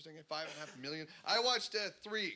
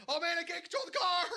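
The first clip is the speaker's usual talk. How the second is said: much higher, louder mock panic yelp; panicked driver; 6 dB louder than usual. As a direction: yelp like the car's sliding away. Panicked Atlanta driver on ice.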